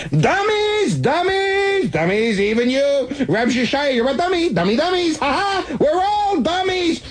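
high, giddy triumphant crowing; manic glee. A narrator's reading. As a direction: crow like a man gone joyfully mad. Narrator's manic triumphant outburst.

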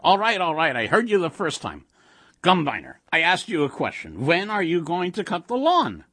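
put-on impatient old-wife voice; exasperated wife. A narrator's reading. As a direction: brush one off and scold the other. Wife snaps at monster, nags husband.

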